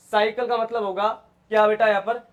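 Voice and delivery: ordinary talk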